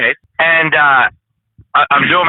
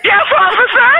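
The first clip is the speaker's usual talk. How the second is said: embarrassed squeak of a reply; high-pitched voice. Retold or imitated. Driver caught mid-business answering the officer, squeaky high voice. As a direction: answer in a squeaky, much higher voice. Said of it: "you had the high-pitched voice".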